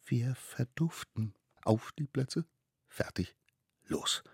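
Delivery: hushed countdown to a getaway; whispering little one. Acted. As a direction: softly plot the escape in a child's voice. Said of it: "flüsterte der Allerkleinste". (heimlich flüsterndes Kind)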